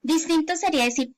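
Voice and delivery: ordinary talk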